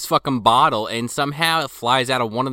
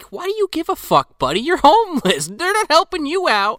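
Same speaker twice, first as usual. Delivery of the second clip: incredulous yell. Retold or imitated. Host voicing himself mocking the patriotic homeless man, high incredulous yell. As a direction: scoff at him, voice leaping up. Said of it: "And I'm just like".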